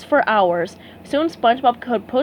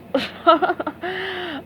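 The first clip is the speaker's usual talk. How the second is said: chuckle; 7 semitones higher than usual. A narrator's reading. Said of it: "The agent chuckled".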